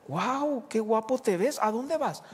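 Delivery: high, bright admiring wow; delighted surprise. Retold or imitated. Wife amazed to see husband in a suit. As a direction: sound pleasantly amazed.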